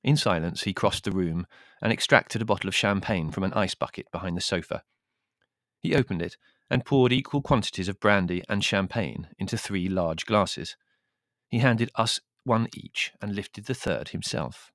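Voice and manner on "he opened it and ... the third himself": ordinary delivery, nothing marked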